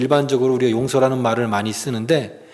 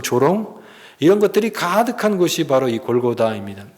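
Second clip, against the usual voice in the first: ordinary delivery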